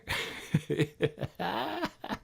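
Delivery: soft laugh at his own joke; quiet giggle, trailing off; soft chuckle after a sly dig; amused chuckle. Real, not acted. Host laughing at snakeskin joke.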